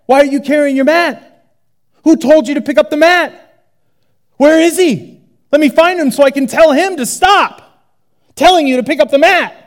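high, loud, nagging interrogation; mock irritated leaders; fussy officials. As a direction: badger him about the mat. Leaders voiced, fixated on mat.

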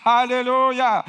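high, loud exultant shout; cry of faith. Acted. Blind man's cry of faith, a loud exultant shout.

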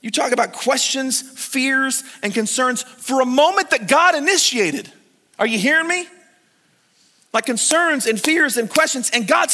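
fired-up sermon delivery; preaching. Acted. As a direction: preach it out to the room. Preaching at full force.

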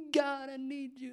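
high, airy worship-time cry; hammed-up devout pleading in a high pitch; mock worship plea. Imitates self singing worship.

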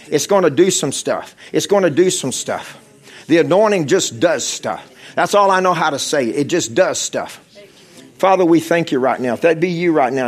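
loud, driving pulpit preaching to the congregation; fervent preaching. Acted. Preacher urging the congregation forward, fervent and loud. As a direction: push the altar call hard with full force.

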